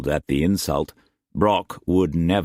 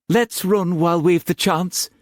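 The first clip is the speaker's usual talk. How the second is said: begging; 12 semitones higher than usual. A narrator's reading. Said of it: "she begged".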